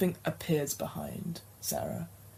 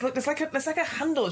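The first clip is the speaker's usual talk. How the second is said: high female voice; 9 semitones higher, faster than usual. Acted. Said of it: "She says".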